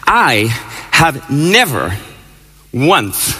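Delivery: I ... have never ... once, each word set apart with pauses; measured pauses. Real, not acted. Speaker to audience about holding hands, with weighty pauses. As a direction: let each word land alone, slowly. Speech, pauses between words.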